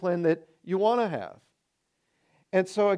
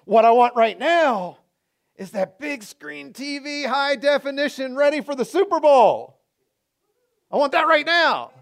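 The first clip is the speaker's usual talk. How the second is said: greedy craving; 8 semitones higher, 6 dB louder than usual. Retold or imitated. Acting out craving voice.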